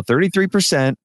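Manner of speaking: lightly voiced twelve-year-old repeating a prompt; imitated kid repeating. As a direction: pitch it up like a child copying an adult. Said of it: "And he'll go".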